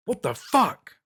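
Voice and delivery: high, loud shocked yell; a startled yell. A narrator's reading. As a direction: yell out in sudden fright. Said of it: "he yelled".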